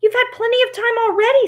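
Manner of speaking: high, fast indignant protest; angry. Acted. Tin Woodman objects to Oz's delay, angrily. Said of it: "said the Tin Woodman angrily".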